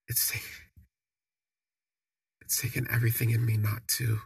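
whispered, fighting tears; barely voiced, choked up; holding back tears. Real, not acted. Narrator near tears after the hug, speaking in a whisper. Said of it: "break out in tears".

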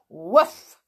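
loud barked woof; dog's woof. Acted. Timmy the dog answering George with a loud woof. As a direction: bark it out like a happy dog.

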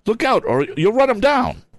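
high, urgent warning; alarmed cry. A narrator's reading. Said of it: "cried Sam Snedeker".

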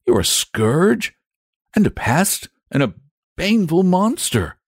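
put-on high-pitched, exasperated young boy; worried boy. A narrator's reading. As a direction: warn the dragon urgently as a boy. Boy warns the dragon.

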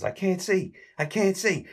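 high, mock panicked wail; whining panic. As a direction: cry out like you've gone blind. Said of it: "Larry says".

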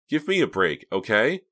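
high, loud fed-up retort; exasperated. A narrator's reading. Reva wants Hank to back off, exasperated. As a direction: push back with swooping, emphatic pitch.

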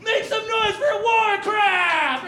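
high, slow, stretched shout to rile the crowd; hype call. Retold or imitated. Comic rallying Warcraft fans, slow high shout. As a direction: call out like a stadium emcee.

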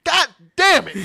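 loud exasperated curse; frustrated outburst. Real, not acted. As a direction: spit it out annoyed.

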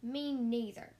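a hint of a loud little joey; young kangaroo. A narrator's reading. Young kangaroo echoing mother, lightly voiced loud chime-in. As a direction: chime in emphatically from the pouch. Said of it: "young kangaroo in her pouch said".

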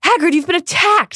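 alarmed friend; Ron's voice. Acted. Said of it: "said Ron".

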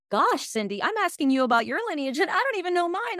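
high-pitched, exaggerated dawning dismay; mock realization. Person asking Cindy about lineage, comically dismayed. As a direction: imitate a flustered friend catching on. Acting others realizing their own ignorance.

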